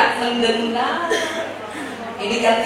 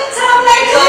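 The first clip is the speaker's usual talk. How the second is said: high, sing-song hammed-up greeting; overeager; 9 semitones higher, 4 dB louder than usual. A husband bursting in the door to his wife, overeager. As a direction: gush it out the second the door opens. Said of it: "Mau punya suami kayak gitu".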